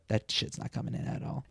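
hushed private thought; barely voiced aside to self; inner thought whispered. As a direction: whisper it to yourself. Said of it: "I immediately thought".

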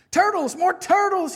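loud, high braying call; big pompous yell; bellowed. Acted. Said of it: "he bellowed and brayed".

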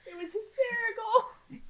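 high laughing wail. Real, not acted. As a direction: squeal it through laughter.

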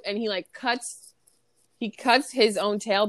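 ordinary talk

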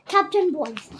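ordinary delivery, nothing marked

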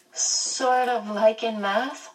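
put-on a curious girl checking if she got it; Meg's voice. A narrator's reading. Meg asks, child voice.

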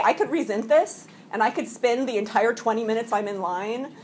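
ordinary talk, nothing marked

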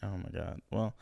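normal talking voice

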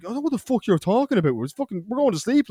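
fast, high-pitched protest; put-on outraged innocence; mock indignant denial. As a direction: play the wronged sleeper. Acted denial while pretending to sleep.

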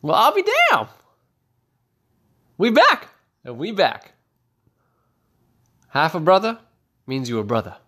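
high delighted exclamation; delighted exclaim. Acted. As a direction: whoop with sudden joy. Guard delighted at news.

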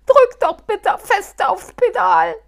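high, loud desperate cry; near tears. Acted. Halbschuh near tears begging Muff to keep driving. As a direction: plead close to tears. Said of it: "nun schon fast weinend".

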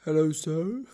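lightly deepened polite waiter voice; a hint of a deep-voiced waiter greeting. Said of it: "haben wir die tiefe Stimme".

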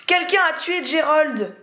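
high, slow, screaming outburst; shrieked accusation; sudden scream. A narrator's reading. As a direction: scream it out of nowhere. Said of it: "hurla-t-elle soudain".

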